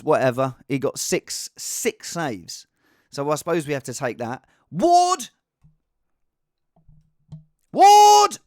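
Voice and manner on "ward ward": high, loud cry of the name; exasperated yell. Acted. Streamer reviewing his team, yelling a player's name. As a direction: bellow the surname, rising in force.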